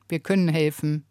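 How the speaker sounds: ordinary delivery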